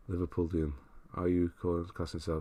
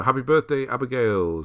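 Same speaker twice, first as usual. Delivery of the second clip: loud, higher-pitched celebratory call; birthday shout-out; 5 semitones higher, 8 dB louder than usual. Real, not acted. Streamer wishes a viewer happy birthday, loud shout-out. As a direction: sing out the good wishes loudly.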